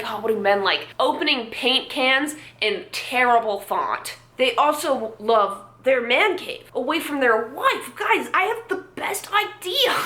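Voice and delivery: hammed-up pitch climbing to a high finish; mock a clueless idea man, building in excitement; mock marketer. Mocks marketers pitching candles for men.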